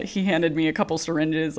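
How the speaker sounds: normal talking voice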